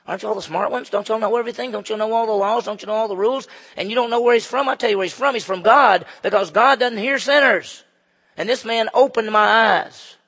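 loud, high, mounting volley of questions; indignant challenge. Acted. Healed beggar schooling the religious leaders, indignant.

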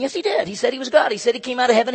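high, loud mock insistence; insistent crowd.

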